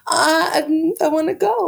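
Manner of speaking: high-pitched mock whine of longing; imitated pleading. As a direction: imitate a teen begging to come along. Acted quote of eager young woman.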